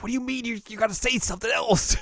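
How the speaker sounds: high-pitched, incredulous retort; mock exasperation, voice shooting way up; exasperated disbelief. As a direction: squeak it in disbelief. Flustered reaction to frozen director retold.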